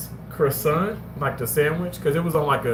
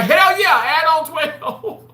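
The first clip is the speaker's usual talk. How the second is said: high, loud eager yell, slow on the end; eager mock shout; 13 semitones higher, 6 dB louder, slower than usual. Host reenacting ordering extra shrimp, shouting eagerly. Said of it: "I'd be like".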